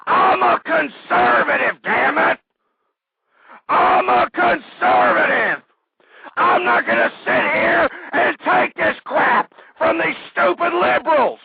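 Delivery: raging shout that cracks near a cry; furious shouting. Real, not acted. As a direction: scream it at the liberals like your throat will tear. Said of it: "that's why I have a damn torn voice box".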